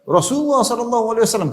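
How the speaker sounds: ringing high-pitched pulpit call; preacher's proclamation. Acted. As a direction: proclaim it like a khatib at Friday sermon. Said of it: "khatib mengatakan".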